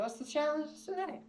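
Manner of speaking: mimicking himself asking the host about the day's challenge; mock innocent question. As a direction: ask it in a high, put-on innocent voice. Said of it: "And I go".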